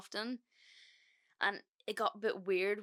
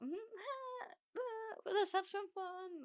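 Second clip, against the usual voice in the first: much higher, laughing giddy squeal; the moment she says yes, giddy and much higher; giddy mock voice; 14 semitones higher, 6 dB quieter, much slower than usual. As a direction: play your tipsy carefree self. Giddy inner thought, retold.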